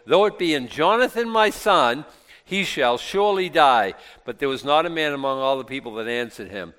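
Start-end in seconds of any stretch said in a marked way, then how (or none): 0.1-3.9 solemn oath. Acted. Saul's oath against his son.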